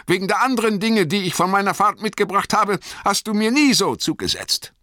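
high, loud, fast accusation; Bilbo, scharf vor Ärger. A narrator's reading. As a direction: rattle off the grievance sharply, pitched high. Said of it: "Sie klang scharf vor Ärger und Argwohn". (scharf vor Ärger und Argwohn)

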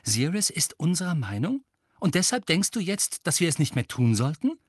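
a touch of agitation, pitch climbing; upset. A narrator's reading. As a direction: challenge it with incredulity. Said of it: "sagte Harry aufgebracht".

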